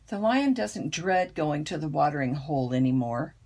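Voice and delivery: ordinary delivery, nothing marked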